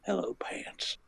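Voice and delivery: very soft whispered impression; creepy greeting. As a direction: whisper it as an odd actor greeting the audience. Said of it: "where he just greets the audience going".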